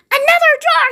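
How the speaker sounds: high, loud burst of joy; excited cry. A narrator's reading. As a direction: shout it out, thrilled. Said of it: "he cried".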